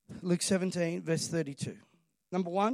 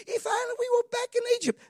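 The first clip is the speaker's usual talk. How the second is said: much higher mock whining voice; whiny complaint. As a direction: whine it like a grumbling Israelite. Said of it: "They complain".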